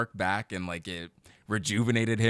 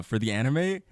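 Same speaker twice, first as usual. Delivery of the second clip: usual speaking voice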